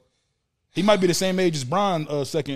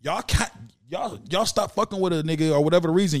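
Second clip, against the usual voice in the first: normal talking voice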